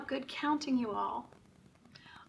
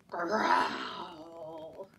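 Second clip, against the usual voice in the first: low growling bear; 5 semitones lower than usual. Pretend. Book animal sound.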